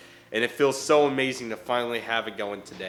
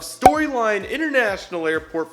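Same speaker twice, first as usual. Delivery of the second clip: excited; 7 semitones higher, 6 dB louder than usual. Real, not acted. Energetic channel intro greeting.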